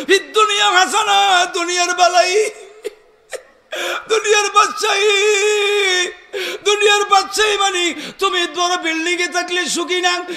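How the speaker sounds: high, loud chanted exhortation; full-force preaching. Real, not acted. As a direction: draw out the calls and ring them out to the crowd.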